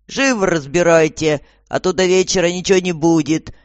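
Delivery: loud, impatient command; barked order. A narrator's reading. Guard hurrying new prisoners, loud scolding. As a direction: order them about loudly. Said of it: "прикрикнул на новеньких охранник".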